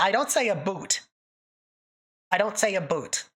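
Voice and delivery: ordinary talk, nothing marked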